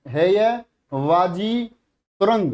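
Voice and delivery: very slow and deliberate, word by word; slow dictation. Shown as a demonstration. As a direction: spell it out slowly for children writing. (बच्चों को लिखवाते हुए बहुत धीरे बोलना)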